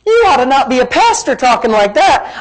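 high-pitched mock objection; put-on reproach, starting very high; shocked congregant. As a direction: mimic a scandalised churchgoer. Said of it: "say, "Pastor".

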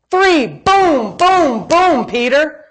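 high, punchy play-by-play of blows; hammed-up knockout count; mock punches. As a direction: land each boom like a punch. Mimes blows landing on Peter.

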